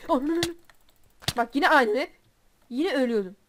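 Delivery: sudden loud swearing burst; raging curse. Real, not acted. Gamer cursing when killed again, loud rage. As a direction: explode as you die again.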